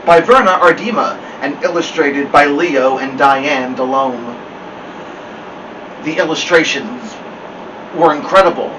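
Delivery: ordinary delivery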